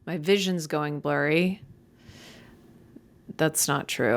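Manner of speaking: ordinary talk, nothing marked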